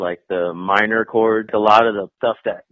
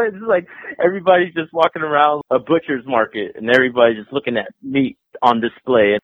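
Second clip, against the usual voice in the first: ordinary talk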